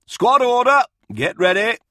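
very loud, high parade-ground shout; calling out. A narrator's reading. Officer walking the line of troops, shouted order. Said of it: "called out, walking the line".

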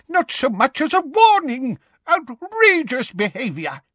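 put-on high indignant old voice; outraged. A narrator's reading. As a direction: splutter with outrage. Tofty outraged at the attack.

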